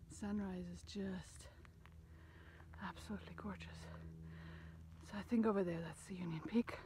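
soft hushed whisper. Real, not acted. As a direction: whisper it, taking in the view. Quiet sunrise moment on trail.

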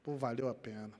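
normal talking voice

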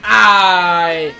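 usual speaking voice

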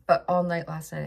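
usual speaking voice

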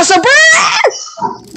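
rolling brrr like a revving chainsaw; chainsaw noise. Retold or imitated. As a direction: buzz it out like a saw starting up. Imitating chainsaw sound.

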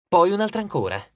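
ordinary delivery

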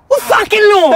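high, loud incredulous yell; outraged, shouting the question; furious shout. Acted. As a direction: bellow it back at him in disbelief. Confrontation over crossing the line.

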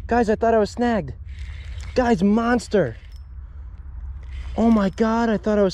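thrilled shouts at a huge catch; excited. Real, not acted. Big smallmouth hooked, shouting.

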